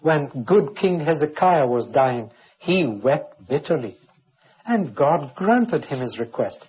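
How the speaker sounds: ordinary delivery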